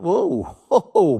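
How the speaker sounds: high, laughing startled whoa whoa. Retold or imitated. Receptionist startled seeing Bob, high laughing whoa. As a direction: recoil at the sight of him. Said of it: "receptionist says".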